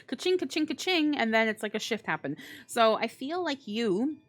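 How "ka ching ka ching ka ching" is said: high, bouncy ka-ching, ka-ching, ka-ching; mock cash register. Reader celebrating the money card with a high cash-register ka-ching. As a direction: sing out ka-ching like a till ringing.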